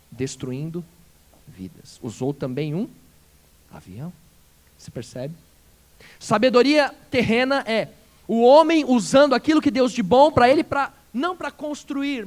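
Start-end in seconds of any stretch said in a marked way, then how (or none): 6.2-12.3 louder, driving pulpit voice with slowed punches at the end; forceful preaching. Acted. Pastor urging the congregation, forceful preaching. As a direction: preach it out to the whole church.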